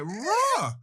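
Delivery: a hammed-up angry roar sound; mock roar. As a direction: bark out a cartoon roar. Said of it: "And I was like".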